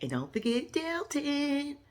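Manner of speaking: high and slow, lovestruck; high, slow mock swoon; slow high dreamy voice. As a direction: drawl his name like a smitten girl. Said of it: "She was like".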